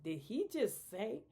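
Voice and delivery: shocked. Retold or imitated. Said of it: "I'm like".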